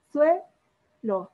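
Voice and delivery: suelo in two beats, SUE held up, lo dropped; teacher sounding out SUE... lo in suelo; stress on SUE in suelo. Shown as a demonstration. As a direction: lift SUE in suelo, then let lo fall. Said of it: "Sílaba tónica".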